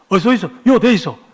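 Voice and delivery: loud, brusque Gyeongsang greeting; Gyeongsang accent. Shown as a demonstration. Busan parking attendant directs arriving drivers, brusque Gyeongsang accent. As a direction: bark it in Busan dialect. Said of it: "경상도 말이 그런 거 아닙니까?".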